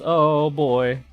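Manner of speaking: ordinary talk, nothing marked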